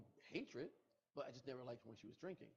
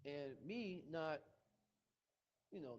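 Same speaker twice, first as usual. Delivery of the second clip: usual speaking voice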